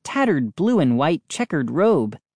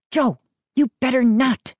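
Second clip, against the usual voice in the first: alarmed whisper; 10 semitones higher than usual. A narrator's reading. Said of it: "Mindy whispered in alarm".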